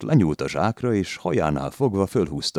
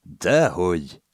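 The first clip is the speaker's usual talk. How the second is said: usual speaking voice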